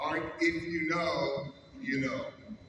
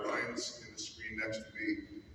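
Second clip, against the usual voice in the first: usual speaking voice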